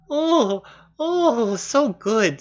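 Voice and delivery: high, loud fawning oohs; mock gushing. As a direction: imitate a crowd swooning over a celebrity. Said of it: "And everyone's like".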